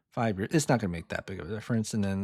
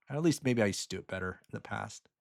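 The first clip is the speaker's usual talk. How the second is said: ordinary talk, nothing marked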